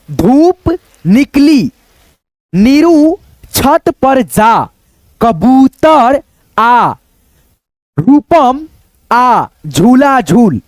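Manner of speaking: drawn out with long pauses between phrases; slow dictation reading. Shown as a demonstration. Teacher reading example sentences slowly to students. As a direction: read each sentence slowly and clearly. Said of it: "फिर यहाँ पे देखो".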